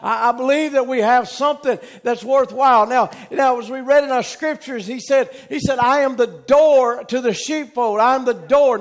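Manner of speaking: full-voiced, emphatic sermon delivery; preaching. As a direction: preach it to the congregation, driving each key phrase home.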